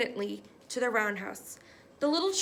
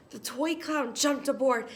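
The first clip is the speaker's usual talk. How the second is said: ordinary delivery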